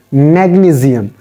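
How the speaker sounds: "magnesium" with a z for the s; corrected magnesium. Shown as a demonstration. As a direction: say "magnesium" as mag-nee-zee-um. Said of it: "It is magnesium".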